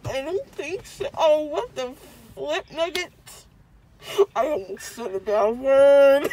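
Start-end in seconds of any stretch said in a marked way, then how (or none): 4.3-6.3 crying. Real, not acted. Drugged child after dental surgery.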